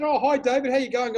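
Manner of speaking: imitating a cheerful neighbour; cheery neighbour. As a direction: play the breezy neighbour calling over the fence. Voicing the neighbour's cheery greeting.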